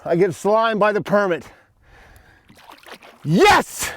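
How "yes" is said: high, sharp cheer; triumphant yell; excited yes. Real, not acted. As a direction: punch the air with it. Cheers as the fish swims off.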